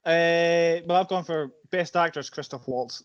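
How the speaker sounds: ordinary talk, nothing marked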